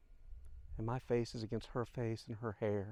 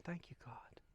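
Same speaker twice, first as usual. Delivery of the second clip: whispered thanks, far softer; hushed prayer; 5 semitones higher, 12 dB quieter than usual. Retold or imitated. Widower silently thanking God in his mind, hushed whisper.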